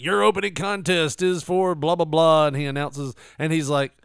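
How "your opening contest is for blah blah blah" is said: louder, higher ring announcer; announcer impression. Mocking the ring announcer's delivery, louder.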